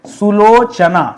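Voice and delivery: सुलोचना said slowly, every syllable spaced; slow clear model word. Shown as a demonstration. Teacher drilling the correct word, slowly. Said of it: "सुलोचना शब्द है".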